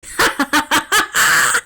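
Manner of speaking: high, loud maniacal cackle; crazed villain laugh. Acted. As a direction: cackle like a madman. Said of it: "crazed laughter escaping".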